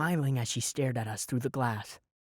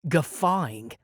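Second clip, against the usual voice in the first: plain reading voice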